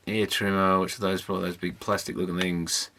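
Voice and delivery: ordinary delivery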